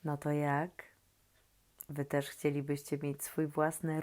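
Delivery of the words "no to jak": ordinary delivery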